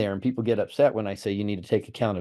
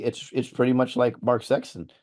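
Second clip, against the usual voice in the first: ordinary delivery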